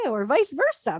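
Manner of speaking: usual speaking voice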